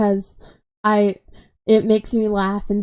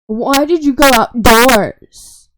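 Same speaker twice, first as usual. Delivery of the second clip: slow, much louder mock grandma demand; imitating grandma; 3 semitones higher, 8 dB louder, slower than usual. Grandma questioning her for going outside, loud slow mock voice. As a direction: drag it out like a nosy grandma. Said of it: "and then she was like".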